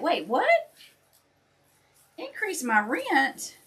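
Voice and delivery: high, startled double take; shocked disbelief. Retold or imitated. Retelling to viewers her shock at the rent hike, high and startled. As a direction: act floored by the news. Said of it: "And I'm like".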